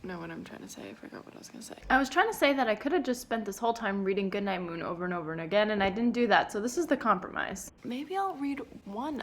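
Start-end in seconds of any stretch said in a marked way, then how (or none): 1.9-9.2 loud, fast ranting; exasperated rant. Real, not acted. Sleep-deprived, she rants loudly about her reading choice. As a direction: blow up and rattle it off.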